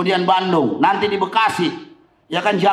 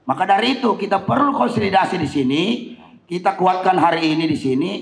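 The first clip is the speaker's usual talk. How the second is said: normal talking voice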